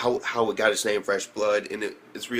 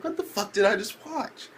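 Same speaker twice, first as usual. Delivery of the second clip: incredulous; 11 semitones higher than usual. Retold or imitated. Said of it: "I'm like".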